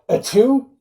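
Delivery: a loud, high, hammed-up sneeze; mock sneeze. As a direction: fake a big sneeze for laughs. Robbins sneezes in retold scene.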